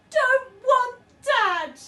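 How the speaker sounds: high, very loud, slow crying bellow; bellowing baby. A narrator's reading. Baby refusing dad, bellowing. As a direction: bellow it through tears. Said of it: "bellowed the baby".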